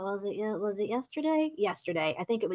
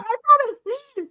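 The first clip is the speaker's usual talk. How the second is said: high, slow, shy little promise; shy sweet voice; 12 semitones higher, 3 dB louder, slower than usual. Retold or imitated. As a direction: say it high and bashful. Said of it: "he's like".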